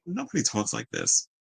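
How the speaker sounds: deadpan disbelief. Retold or imitated. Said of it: "But I'm like".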